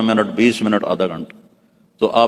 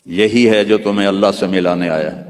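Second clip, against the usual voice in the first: ordinary delivery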